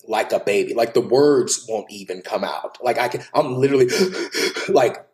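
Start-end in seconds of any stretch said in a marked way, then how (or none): 3.9-4.6 gasping for breath mid-sob; sharp gasp. Real, not acted. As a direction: catch a ragged breath. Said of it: "I can't catch my breath".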